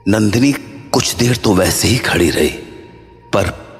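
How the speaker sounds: plain reading voice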